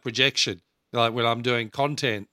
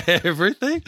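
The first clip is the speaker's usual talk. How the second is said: higher, louder mock disbelief; louder incredulous repeat; 6 semitones higher, 5 dB louder than usual. Coach to a client who says he's hopeless, repeating in disbelief. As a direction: push the word Everything? up in disbelief. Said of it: "I said".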